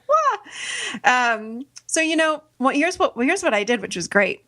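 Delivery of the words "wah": mock crying wail. Showing how she fell apart on the phone, a mock baby wail. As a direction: wail like a child having a tantrum. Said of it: "I was like".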